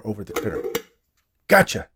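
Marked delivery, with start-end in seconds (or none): 1.5-1.9 short, high, loud exclamation; shouted. A narrator's reading. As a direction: pounce on it with a raised voice. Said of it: "he shouted".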